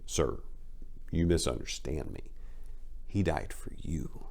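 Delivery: soft voice. A narrator's reading. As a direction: gently set Bumpy straight. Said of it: "his voice soft".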